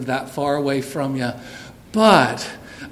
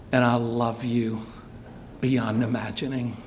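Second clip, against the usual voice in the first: tender; 4 semitones lower, 3 dB quieter than usual. Real, not acted. Father's blessing before the aisle.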